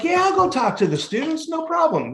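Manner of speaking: high, cocky imitation of his younger self; big-ego bravado. Newly sober speaker recalls agreeing to address students, big-ego bravado. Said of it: "I'm big ego back then".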